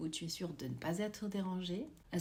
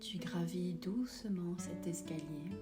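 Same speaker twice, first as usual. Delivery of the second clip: guided meditation; 7 dB quieter than usual. Acted. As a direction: guide them gently into calm.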